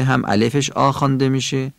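ordinary delivery

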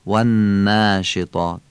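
والناشطات slowly, with a thin alef; light alef. Shown as a demonstration. Teacher modelling the whole word.

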